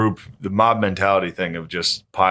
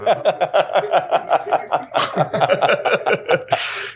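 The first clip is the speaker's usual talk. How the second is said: breathy high wheeze of laughter; wheezing laugh; 8 semitones higher than usual. Real, not acted. Big laugh at own joke.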